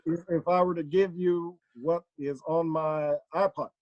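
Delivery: deliberate, hesitant statesman; Barack Obama. Acted. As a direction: stutter at the start, then measure out the answer. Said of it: "here, ask Obama a question".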